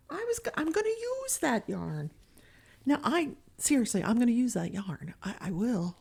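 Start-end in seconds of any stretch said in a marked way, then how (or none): 0.1-2.1 put-on high pleading old woman; whiny old lady. Grandma defending her yarn to the cleaners, high whine.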